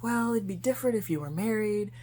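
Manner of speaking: lifted, put-on "well, actually" voice; quoted sister-in-law. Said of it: "conversation with my sister-in-law".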